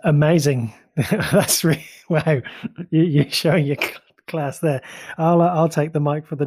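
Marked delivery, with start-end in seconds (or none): none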